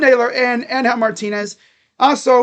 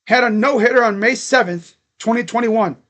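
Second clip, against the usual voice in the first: ordinary talk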